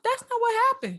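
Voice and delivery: put-on high laughing kid protest; high giggly kid voice; sassy kid. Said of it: "I was like".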